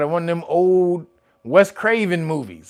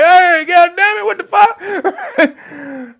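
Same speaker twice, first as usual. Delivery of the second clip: high, loud laughing outcry, slowing at the end; shocked disbelief; 10 semitones higher, 4 dB louder, slower than usual. Retold or imitated. Reacting to the mutant cow picture, laughing outburst. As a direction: laugh through your disbelief. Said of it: "I was like".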